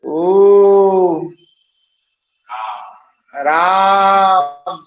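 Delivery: long stretched call of "ओ राम", the final vowel held very long and loud; drawn-out pluta vowel. Shown as a demonstration. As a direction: call "ओ राम" like shouting to someone far away, holding the vowel. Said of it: "इसमें तीन मात्रा कहलाता है".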